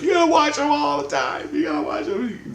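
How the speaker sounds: high, insistent warning; wife warning. Retold or imitated. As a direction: urge him to keep watch. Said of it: "my wife's like".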